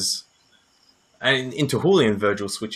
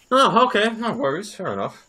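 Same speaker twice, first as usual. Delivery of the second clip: nervous backing off; 9 semitones higher than usual. Acted. Raylan backs off nervously.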